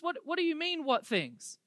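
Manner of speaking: baffled comeback, acted out; incredulous. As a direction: play it mildly incredulous. Cleo's imagined disbelief at Jesus' question.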